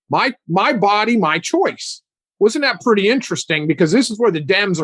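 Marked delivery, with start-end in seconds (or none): none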